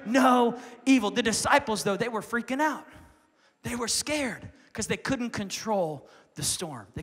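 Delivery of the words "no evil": loud, rising preaching. Real, not acted. Preacher rallies the church against Goliath, loud and rising. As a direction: stride toward the giant with faith. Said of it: "preaching at full force".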